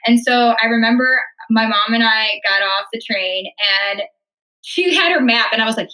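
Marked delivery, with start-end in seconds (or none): none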